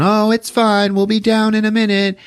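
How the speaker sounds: high-pitched motherly call; mom yelling down. Acted. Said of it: "The mom just yells down".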